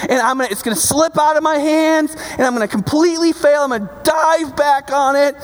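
high, breakneck determination building as it goes; preacher worked up to a high pitch; fired-up preaching. Acted. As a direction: act out a desperate wrestling match. Acts out wrestling the fish.

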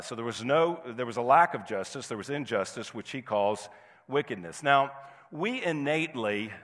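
usual speaking voice

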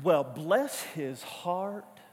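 slow Southern drawl, bless his heart; Southern accent. Retold or imitated. Pastor slipping into a Southern accent with a church member. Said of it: "I start to kinda take it on".

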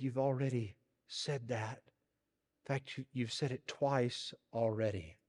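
soft, close whisper; whispered aside. A narrator's reading. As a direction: lean in and whisper it. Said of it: "he whispered in his ear".

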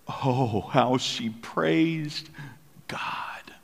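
slow, breathy whisper of wonder; hushed awe. Acted. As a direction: let it fall to a reverent whisper. Awed whisper of praise.

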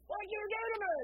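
high-pitched mock outraged yell; alarmed biker demand. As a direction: act out a stuck biker's accusing cry. Stuck biker confronts the preacher.

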